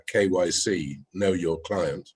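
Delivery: ordinary talk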